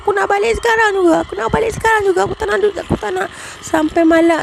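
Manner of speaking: high, rushed, upset protest; fast pleading, pitched well up; retold insistent demand. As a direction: act out begging to go home at once.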